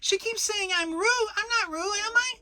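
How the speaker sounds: tiny piglet begging for help; pleading Piglet. A narrator's reading. Piglet begging Christopher Robin to set Kanga straight, high plea.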